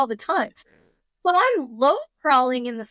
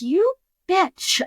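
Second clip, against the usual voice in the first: annoyed outburst; 8 semitones higher, 4 dB louder than usual. Retold or imitated. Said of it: "I'm like".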